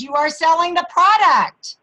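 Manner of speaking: insistent, loud push on "selling the product"; stress on selling the product. Shown as a demonstration. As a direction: punch "selling the product" emphatically. Contrasts selling with ordering.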